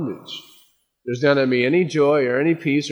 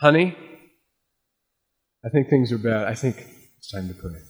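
hushed, lower voice fading down; worried husband; 3 semitones lower, faster than usual. Retold or imitated. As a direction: let it sink to a near whisper. Husband telling wife in crisis.

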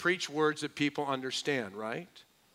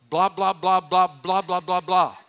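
gibberish babble; 3 semitones higher, 8 dB louder than usual. Pretend. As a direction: babble nonsense at the preacher. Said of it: "you just go".

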